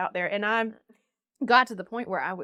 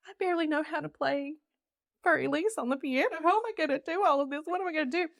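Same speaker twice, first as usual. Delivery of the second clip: mock panic; 7 semitones higher than usual. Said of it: "I sat there crying".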